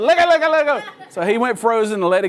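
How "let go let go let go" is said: high, urgent repeated command; mock panic. Dad to son holding the key too long, panicky. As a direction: act out a dad's alarm, pitched up.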